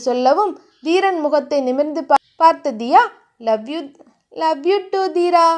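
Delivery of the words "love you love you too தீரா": put-on loving girlfriend. Heroine looks up at her lover, sweetly loving. Heroine returns his love.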